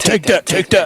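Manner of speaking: laughing mock taunt; mock guard taunt. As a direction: shout it like a jeering guard. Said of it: "corrections officers are just like".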